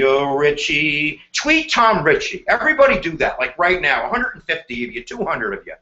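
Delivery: ordinary talk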